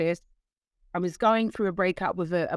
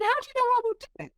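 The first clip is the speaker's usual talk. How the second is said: much higher and fast, a squeak of disbelief; mock-astonished challenge to the woman at church; incredulous; faster than usual. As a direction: retort as if she can't believe it. Retold disbelieving reply.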